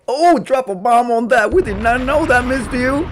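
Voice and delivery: high, loud gasping mock shock. Host gasping at a viewer's confession, high and loud. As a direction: gasp it out like scandalous gossip.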